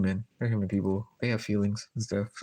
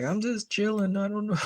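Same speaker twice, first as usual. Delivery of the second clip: casual shrug; 11 semitones higher than usual. Retold or imitated. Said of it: "I was like".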